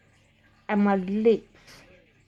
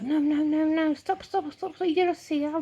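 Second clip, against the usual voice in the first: high, pleading stop stop; panicked no; 6 semitones higher than usual. Pretend. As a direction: beg your body to stop. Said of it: "my bones were like".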